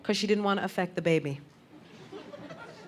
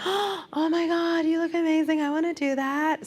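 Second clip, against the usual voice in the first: gushing; 9 semitones higher than usual. Retold or imitated. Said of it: "goes, gasps".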